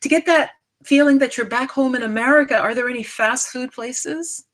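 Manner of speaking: a hint of a naive, chirpy woman; mocking quote of the woman. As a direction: lightly mimic her breezy question. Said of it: "and the woman said".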